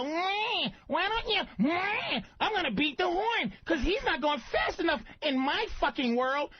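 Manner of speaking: high, whiny nagging woman voice; mock whiny woman. Mocking women drivers in a high whine. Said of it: "They go".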